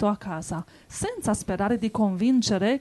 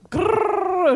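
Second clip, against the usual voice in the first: high, snarling mock growl; wolf growl; 9 semitones higher than usual. A narrator's reading. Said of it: "ringhiò il lupo".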